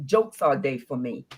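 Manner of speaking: ordinary talk, nothing marked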